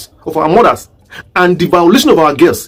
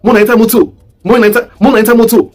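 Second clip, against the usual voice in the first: put-on Rivers State accent; 3 semitones higher, faster than usual. Mimicking the sit-at-home enforcers in a Rivers State accent. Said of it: "No Igbo man will speak like that".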